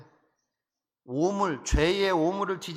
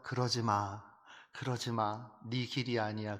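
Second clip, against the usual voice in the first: low, softer coaxing, retold; gentle dissuading; 5 semitones lower, 6 dB quieter than usual. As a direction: quietly talk someone out of it. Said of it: "설득하고". (나직이 타이르며 말리듯)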